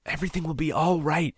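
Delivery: a lightly voiced pleading young man. A narrator's reading. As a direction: beg her to believe it. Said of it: "he pleaded".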